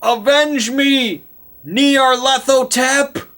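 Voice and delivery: high, slow, drawn-out dying cry; yelled last cry. Retold or imitated. Dying cultist leader calls on his god, yelled high and slow. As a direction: bellow it to the heavens. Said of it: "So he yells out".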